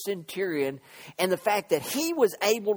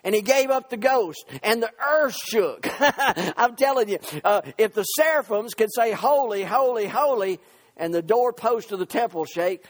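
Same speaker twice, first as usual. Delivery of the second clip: raised, driving pulpit delivery; full-force preaching; 3 semitones higher, 4 dB louder than usual. Real, not acted. Preacher to congregation on the centurion, full-force preaching. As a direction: preach it out with punched words.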